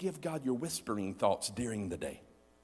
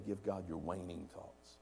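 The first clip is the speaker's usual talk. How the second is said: ordinary talk, nothing marked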